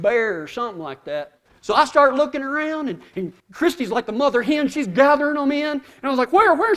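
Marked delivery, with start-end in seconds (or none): 6.3-6.7 high, loud, urgent questioning; mock alarm. Father asking his wife about the animal, mock-frantic. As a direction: act out a frantic search for danger.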